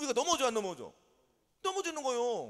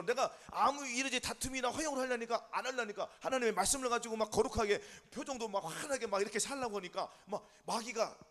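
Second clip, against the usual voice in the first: normal talking voice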